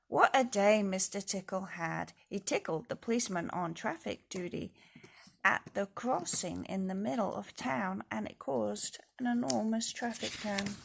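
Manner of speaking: ordinary delivery, nothing marked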